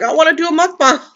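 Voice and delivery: very high, excited little squeal; eager outburst. Retold or imitated. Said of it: "I'm like".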